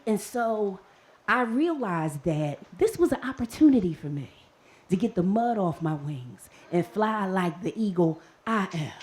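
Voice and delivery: usual speaking voice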